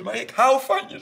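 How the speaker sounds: very high, loud plea; desperate declaration. Retold or imitated. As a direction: cry out your love over the fight. Father's desperate plea in a quarrel. (wanhopig uitgeroepen liefdesverklaring)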